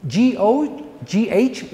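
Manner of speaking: slow, spelling "G O G H" letter by letter. Shown as a demonstration. Teacher spelling the letters of the ghoti joke. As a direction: spell "G O G H" out slowly for the class. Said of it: "like laugh or cough".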